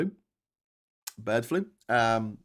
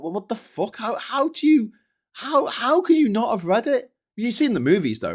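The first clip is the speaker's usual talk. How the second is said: high, loud stammer of disbelief; acted incredulity; 12 semitones higher, 8 dB louder than usual. Fan grilling someone who never read it, acted incredulity. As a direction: stumble upward into mock-baffled questioning.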